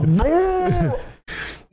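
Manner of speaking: short high wailing cry; high yelp. Retold or imitated. Reenacting the cry from the chair, high and wailing. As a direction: let out a high cry again. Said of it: "I just go".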